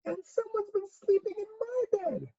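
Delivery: very high, tiny cub voice; wee, squeaky upset cub; crying Baby Bear. Acted. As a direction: squeal it like a tearful little bear. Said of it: "cried the Baby Bear".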